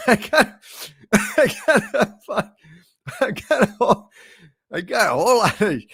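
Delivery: high, loud giggling through the words; losing it mid-line, laughing; cracking up. Real, not acted. Computer voice breaking down into real laughter in the sketch.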